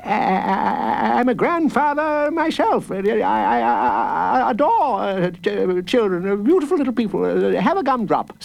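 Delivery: high, stammering, rushed fussy judge; flustered judge. A narrator's reading. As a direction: fumble over every word like a nervous old judge. Judge stammering, offering gumdrop.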